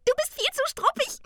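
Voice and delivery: very high teasing creature voice; giggling. A narrator's reading. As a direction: squeak it and break into giggles. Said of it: "kicherte das Tingeli". (hoch gepiepst, mit Kichern)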